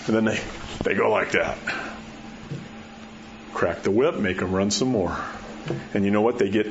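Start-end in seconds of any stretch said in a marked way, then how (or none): none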